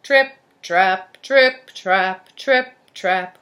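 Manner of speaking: very loud, evenly pounded words; heavy booming footfalls; heavy stomping. A narrator's reading. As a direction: stomp each word like a big goat's hoof. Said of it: "went his feet across the bridge heavily".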